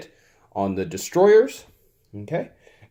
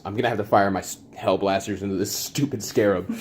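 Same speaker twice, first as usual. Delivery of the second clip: ordinary talk, nothing marked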